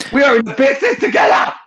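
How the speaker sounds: high-pitched exasperated yell; exasperated shout. Real, not acted. Berating co-hosts who never say love you, shouting. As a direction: shout it in outrage at your friends. Exasperated rant about unreturned affection.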